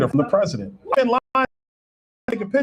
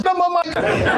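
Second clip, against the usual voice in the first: higher, laughing through a teasing imitation; a light laughing impression of the woman, higher; mock staffer; 11 semitones higher, slower than usual. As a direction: mimic her with a laugh, pitched higher. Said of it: "She goes".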